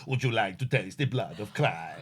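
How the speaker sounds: put-on Spanish accent. Mocking the TV priest offering communion, mock Spanish accent. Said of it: "That's my Spanish accent".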